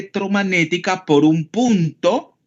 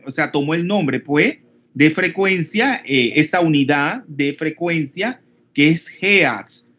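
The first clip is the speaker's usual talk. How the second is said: ordinary talk, nothing marked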